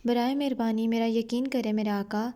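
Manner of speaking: ordinary talk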